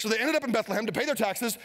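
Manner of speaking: ordinary talk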